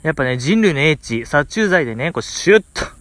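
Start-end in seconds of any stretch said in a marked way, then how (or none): none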